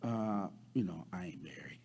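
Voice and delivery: soft, low, hesitant politeness; very polite. Acted. Woman answering Jesus about her husband, very polite. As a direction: demurely slip it out, trailing down. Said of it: "she says very politely".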